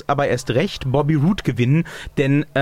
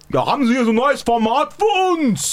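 eager caller voice; 8 semitones higher than usual. Pretend. Caller voice, high.

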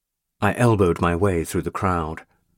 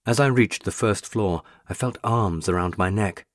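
ordinary delivery, nothing marked

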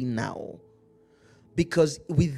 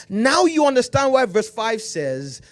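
fiery preaching; 5 semitones higher, 8 dB louder than usual. Acted. Preaching at full force.

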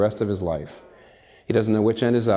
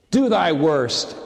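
higher, slow, defiant; 5 semitones higher, much slower than usual. Acted. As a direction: dare death slowly, chin up.

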